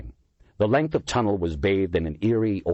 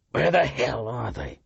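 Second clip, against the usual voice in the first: frustrated muttering; 5 semitones higher than usual. A narrator's reading. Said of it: "to no one in particular".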